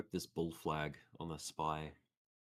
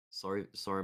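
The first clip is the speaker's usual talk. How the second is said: ordinary talk, nothing marked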